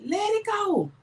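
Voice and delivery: high-pitched, drawn-out soothing lilt; imitating calm husband. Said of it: "the way I'm telling y'all is the way he said to me".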